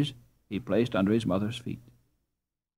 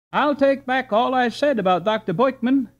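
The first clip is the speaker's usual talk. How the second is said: higher and louder, called after him; calling out; 13 semitones higher, 5 dB louder than usual. A narrator's reading. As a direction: shout it good-naturedly across the distance. Calling to departing boy, laughing.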